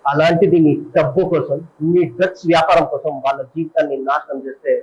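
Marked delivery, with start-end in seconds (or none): none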